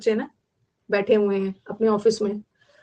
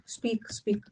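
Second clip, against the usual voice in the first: whispered; 10 dB quieter than usual. Shown as a demonstration. Teacher repeating the word meaning.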